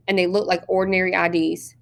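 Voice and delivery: usual speaking voice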